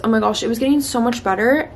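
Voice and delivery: ordinary delivery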